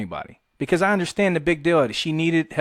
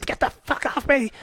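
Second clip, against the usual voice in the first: squealed protest, pitched way up; imitated screaming; 12 semitones higher than usual. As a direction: imitate a woman struggling free. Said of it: "she's kicking and screaming".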